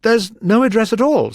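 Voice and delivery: high, loud answer. A narrator's reading. Rabbit answers the juror.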